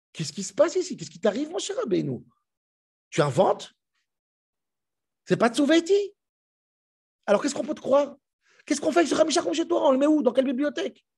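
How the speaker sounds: alarmed challenge to Moshe over the Torah; shocked disbelief. Acted. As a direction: panic rising at each question. Outraged questioning of Moshe.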